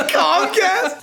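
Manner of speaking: high, laughing repeat; giggling echo. Real, not acted. Laughing at the Comcast joke.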